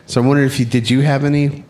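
usual speaking voice

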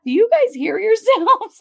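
high mock disbelief; exaggerated disbelief at them; incredulous. Mocking the lawyers' word use.

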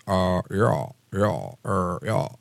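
hesitant, halting mumbled yeah; a lightly voiced confused old senator; impression of McConnell. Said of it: "I'm doing an impression of him".

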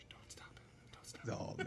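conspiratorial whisper. Retold or imitated. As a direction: hush the others so they don't spoil it. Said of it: "We're like".

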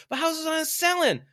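very high, loud hammed-up objection; mock protest. House seller objecting to Art, high mock protest. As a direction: imitate an exasperated follower pushing back.

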